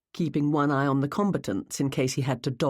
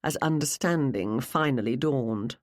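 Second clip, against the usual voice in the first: usual speaking voice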